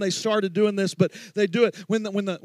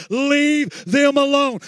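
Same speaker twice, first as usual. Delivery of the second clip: higher, slow drawn-out cry; preached shout; 4 semitones higher, 4 dB louder, much slower than usual. Acted. Pastor pleads with the church to let the teens worship, slowly. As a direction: stretch it out to the congregation.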